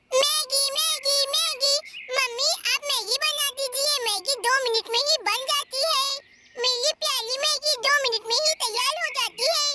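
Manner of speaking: put-on a small girl coaxing mom for noodles; Munni's little girl voice. Munni begs for Maggi.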